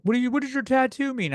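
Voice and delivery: high-pitched mimicry of an annoyed stranger; mock indignant questioner. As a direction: ask it as a baffled, annoyed critic. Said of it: "make people mad when they're like".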